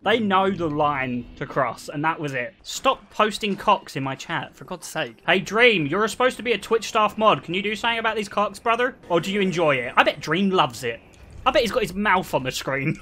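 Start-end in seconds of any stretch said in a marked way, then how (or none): none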